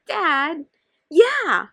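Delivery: high bright laugh into a loud yeah; happy laugh. Acted. Daughter laughing with dad, high and loud. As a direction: laugh with delight.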